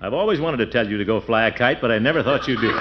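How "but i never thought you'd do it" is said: normal talking voice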